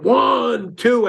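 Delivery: slow, loud, high count of one; stern count. Retold or imitated. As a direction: count it out like a warning to the kids. Counting to three at kids.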